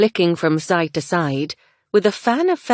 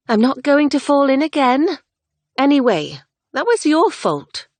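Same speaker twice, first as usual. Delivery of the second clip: high, loud protest; young woman's indignant reply; indignant retort; 10 semitones higher, 5 dB louder than usual. Acted. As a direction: snap back at him, a touch defensive.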